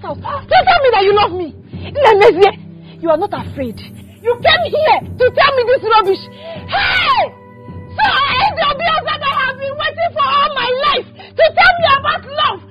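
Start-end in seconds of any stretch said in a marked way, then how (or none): 1.9-12.6 sobbing, shouting fury; woman screaming through tears at the man who wronged her; crying shout. Acted. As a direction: wail it out at him through tears. Woman shouts and cries at suitor.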